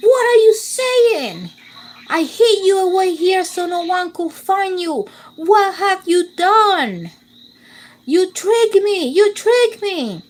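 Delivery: high, furious witch scream. A narrator's reading. Witch screams at Rapunzel, a high furious shriek. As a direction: shriek it out in rage. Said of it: "screamed the witch".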